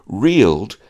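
ordinary delivery

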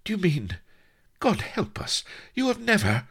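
very high, halting disbelief; high-pitched incredulous lady. A narrator's reading. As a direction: ask in astonished high squeaks, broken by pauses. Astonished mistress, hesitating over question.

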